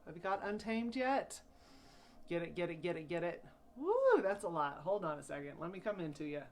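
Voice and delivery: usual speaking voice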